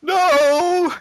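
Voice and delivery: high, slow hammed-up plea; mock Boris pleading. Boris pleading with the bottle, high, slow and hammed-up. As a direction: beg the bottle to stop. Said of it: "Boris struggling with the bottle".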